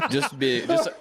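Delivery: high giggling words breaking through laughter; laughing. Real, not acted. Guest laughing at his own flustered answer, high giggly voice. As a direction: laugh it out, embarrassed.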